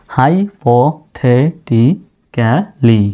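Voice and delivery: Hypothetically drawn out slowly, syllable by syllable; teacher modelling hypothetically very slowly; slow model word. Shown as a demonstration. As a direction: stretch hypothetically out so students hear each sound. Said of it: "এই hypothetically শব্দটির অর্থ".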